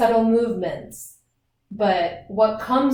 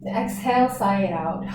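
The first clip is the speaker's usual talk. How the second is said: guided breathing; 4 dB quieter than usual. Acted. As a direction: ease the class into calm, unhurried breaths.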